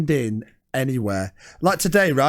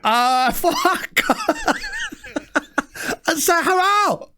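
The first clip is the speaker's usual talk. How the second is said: high, slow fluting posh voice; hammed-up posh voice; 10 semitones higher, much slower than usual. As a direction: overdo a posh salesman. Blending in with solicitor.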